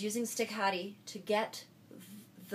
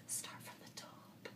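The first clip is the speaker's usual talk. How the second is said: whisper; 17 dB quieter than usual. Shown as a demonstration. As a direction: whisper it very softly. Whispered aside before repeating it aloud.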